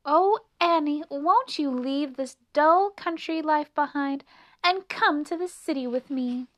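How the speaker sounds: high, loud, pleading invitation; insistent city mouse; coaxing. A narrator's reading. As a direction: urge a friend to come along. City mouse coaxing her friend.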